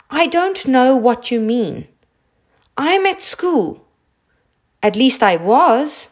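high, swooping schoolboy voice; flustered boy. A narrator's reading. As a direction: explain yourself anxiously in short bursts. Nervous boy answers the queen.

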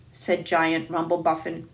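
ordinary delivery

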